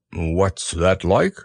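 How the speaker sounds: slow, low gloomy donkey; Eeyore voice. Acted. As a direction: drag it out mournfully. Eeyore speaking.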